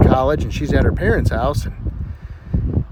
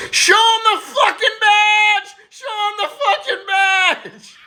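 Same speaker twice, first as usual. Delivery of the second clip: high, slow, desperate shouting across the field; stretched-out panicked hollering; frantic yell; 3 dB louder, much slower than usual. Retold or imitated. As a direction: yell it out to a man running from a bull. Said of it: "little Johnny yells".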